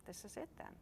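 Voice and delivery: a tiny voice inside, resigned; small inner voice. A narrator's reading. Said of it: "a small voice inside him".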